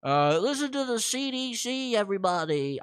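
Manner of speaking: a hint of a fussy doctor; half-hearted imitation; guessed Fauci voice. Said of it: "I don't know what he sounds like".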